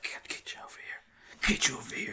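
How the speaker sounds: whispered playful taunt; soft teasing whisper to the dog. Retold or imitated. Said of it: "I'd be like".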